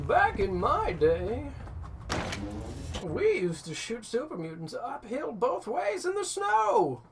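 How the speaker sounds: put-on high, slow grumpy old man; imitating a cranky grandpa. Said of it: "I don't even know if that's very good old man voice".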